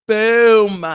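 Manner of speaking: loud punchy exclamation; boom. Real, not acted. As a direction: slam it down for effect. Reacting to the post.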